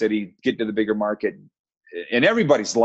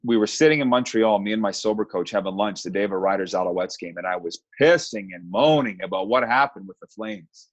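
ordinary delivery

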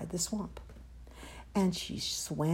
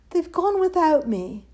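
high, loud lament; plaintive cry; 8 semitones higher, 6 dB louder than usual. A narrator's reading. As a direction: wail mildly at being left behind. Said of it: "And he cried".

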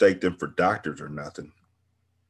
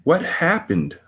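higher, insistent question; urgent repeat; 10 semitones higher, 4 dB louder, slower than usual. Acted. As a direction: demand an answer again. She repeats desperately.

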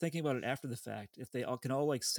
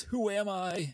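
half-yelled in a raised pitch; mock yell; 7 semitones higher, much slower than usual. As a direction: imitate the boy yelling, pitched up. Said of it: "he yells".

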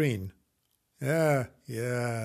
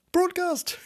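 high, squeaky mock-posh outburst; mock-grand exclamation; 3 dB louder than usual. Podcaster teasing himself for saying broadcast, mock-grand. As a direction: say it far higher, amused at yourself. Mocks own grand word.

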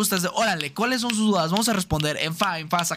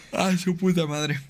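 ordinary talk, nothing marked

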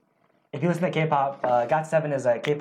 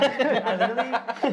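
laughing; 8 semitones higher, slower than usual. Real, not acted. Laughing while telling story.

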